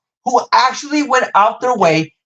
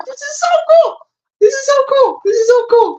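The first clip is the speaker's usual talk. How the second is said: much higher squeal of delight. Real, not acted. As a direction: squeak it out over and over. Repeating in a high squeal.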